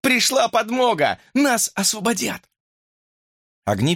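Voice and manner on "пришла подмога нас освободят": high, excited exclamation; overjoyed. A narrator's reading. Hamster announcing that help has come, overjoyed. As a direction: rejoice in a raised pitch. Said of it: "обрадовался хомяк".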